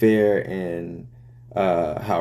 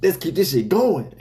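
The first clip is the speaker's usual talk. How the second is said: hyped; 9 semitones higher than usual. Retold or imitated. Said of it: "and I'm like".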